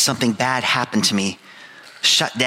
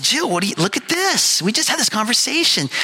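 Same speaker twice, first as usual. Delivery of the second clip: higher, eager, mildly excited call to his wife; a touch of excitement, much higher; 10 semitones higher than usual. Retold or imitated. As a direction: show Jill the ad with mock excitement. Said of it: "I said".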